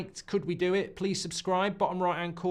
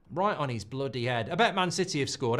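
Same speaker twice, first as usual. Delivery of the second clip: usual speaking voice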